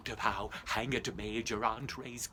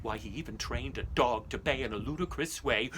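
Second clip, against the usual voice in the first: usual speaking voice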